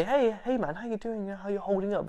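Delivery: high, soft, careful check-in; gingerly friendly greeting. Retold or imitated. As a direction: imitate approaching someone delicately. Retold careful greeting to unstable man.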